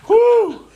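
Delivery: high, laughing whoop; loud whoop. Real, not acted. Listener reacting to a funny story, whooping. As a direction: let out a big high whoop.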